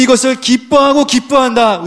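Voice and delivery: high, ringing declaration; exultant preacher's announcement; joyful proclamation. Acted. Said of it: "이렇게 선포해 주시는 것입니다".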